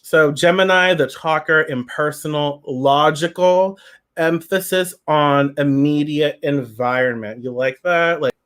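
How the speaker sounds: usual speaking voice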